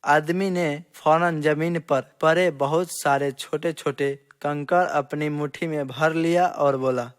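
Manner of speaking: plain reading voice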